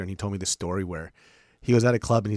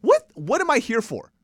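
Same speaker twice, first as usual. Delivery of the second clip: slow, much higher, louder exasperation; exasperated; 13 semitones higher, 8 dB louder than usual. Retold or imitated. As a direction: throw up your hands in disbelief. Doctor's exasperation.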